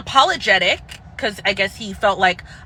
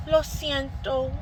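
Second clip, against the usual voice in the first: mock Spanish apology; 4 semitones higher, 8 dB quieter, slower than usual. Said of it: "the waitresses saying".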